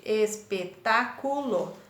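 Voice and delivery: ES - PE - TÁ - CU - LO, slow and separated; teacher sounding out ES-PE-TÁ-CU-LO; split into syllables. Shown as a demonstration. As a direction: say espetáculo in five slow beats, ES, PE, TÁ, CU, LO. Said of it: "Olha como divide".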